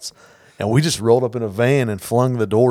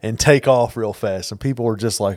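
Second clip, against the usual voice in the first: ordinary delivery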